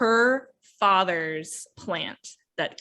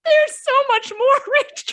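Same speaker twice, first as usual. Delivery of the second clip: high, laughing there's so much more; laughing excitedly; 6 dB louder than usual. Real, not acted. Gushing to Rachel about the book, laughing. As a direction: burst out giggling with excitement.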